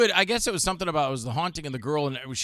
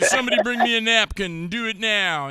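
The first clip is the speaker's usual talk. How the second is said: higher, bossy put-on voice; hammed-up diva order; mock demanding; 7 semitones higher, 4 dB louder than usual. Imitating Rod demanding a napkin, mock imperious. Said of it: "he's just throwing his weight around".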